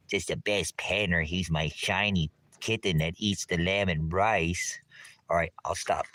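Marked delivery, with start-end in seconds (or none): none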